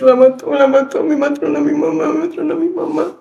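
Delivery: high, distressed repeating; frantic. Retold or imitated. Daughter pacing, unable to calm down, frantic. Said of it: "yo no me tranquilizaba".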